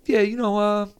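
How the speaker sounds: high-pitched put-on imitation of a friend; Preston. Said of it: "Preston was like".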